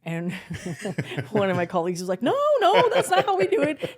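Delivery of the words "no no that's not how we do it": much higher, louder, squeaky panic; mock panicked colleague. Imitating a colleague insisting they ride together, squeaky and loud. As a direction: squeal it like a frantic ant. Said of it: "He acted like".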